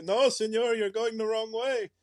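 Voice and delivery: lightly hammed-up Mexican accent; mock Mexican accent. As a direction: play a border crosser warning you, Mexican accent. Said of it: "They'll say".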